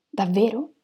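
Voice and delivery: ordinary delivery, nothing marked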